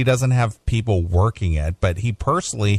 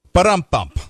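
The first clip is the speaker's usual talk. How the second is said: spoken drum sting, punchy and louder; mock rimshot; 5 semitones higher, 5 dB louder than usual. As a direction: punctuate a bad joke with a vocal drum fill. Mock drum sting after bad joke.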